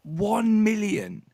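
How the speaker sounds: slow, high, disbelieving; incredulous. Real, not acted. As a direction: drag it out in disbelief.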